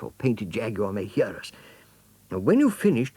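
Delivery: high, quick, eager chatter; excited hedgehog. Acted. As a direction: fuss over your friend excitedly.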